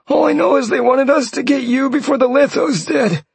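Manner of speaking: high pleading voice of a beaten man; terrified captive. Acted. Captured mage swearing to Sean he killed nobody, high and pleading. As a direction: beg him in a high panicked voice.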